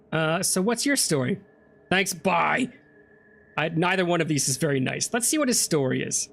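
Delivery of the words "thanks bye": normal talking voice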